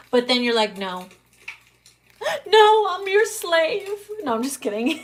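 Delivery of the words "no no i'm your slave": high, slow gasping submissive plea; mock meek lover. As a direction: gasp and melt into hammed-up surrender.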